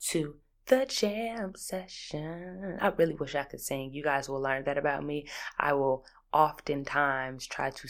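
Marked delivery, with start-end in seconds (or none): 0.1-2.8 slow, drawn-out announcing; segment announcement. Acted. Introducing the jam session to listeners, drawn out. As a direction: stretch it like a show intro.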